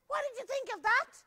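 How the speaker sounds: high, loud proud shout; boy shouting to Grandpa. Acted. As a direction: shout it across the church. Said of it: "he shouted".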